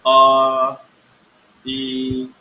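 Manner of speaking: अ stretched long and loud for three beats; drawn-out vowels. Shown as a demonstration. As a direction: hold the vowel well beyond its length. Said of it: "प्लुत स्वरों के उच्चारण में". (प्लुत स्वर खींचकर)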